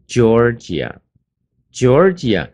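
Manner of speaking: Georgia said slowly and loudly, both times; slow model reading. Shown as a demonstration. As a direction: read Georgia out slowly for the class to repeat. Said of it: "teacher models the name".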